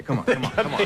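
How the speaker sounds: laughing through a mock drunk pilot's come on; laughing urging. Drunk pilot urging him aboard.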